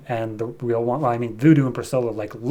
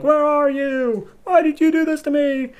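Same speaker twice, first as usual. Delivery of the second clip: mock scream. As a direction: call out into the empty place, hammed-up. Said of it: "So she screams out".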